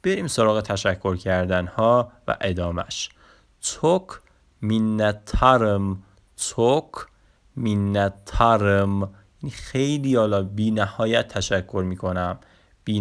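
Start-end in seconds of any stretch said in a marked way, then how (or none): 3.6-9.0 مینترم said slowly as minnettarım after a sharp توک; slow Turkish demo. Shown as a demonstration. Teacher models çok minnettarım for learners. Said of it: "اصطلاحاتمون رو به ترتیب بخونیم".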